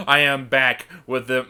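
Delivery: normal talking voice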